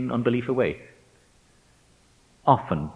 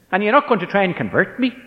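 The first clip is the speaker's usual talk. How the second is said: high, loud, quick Northern Irish question; challenging Northern Irish accent; 11 semitones higher, 6 dB louder, faster than usual. Retold or imitated. Student daring his teacher, challenging Northern Irish accent. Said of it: "blurted out in his north of Ireland accent".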